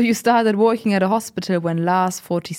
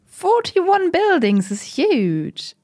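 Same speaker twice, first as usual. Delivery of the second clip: amazed; 8 semitones higher, much slower than usual. Acted. Interviewer amazed at commune size.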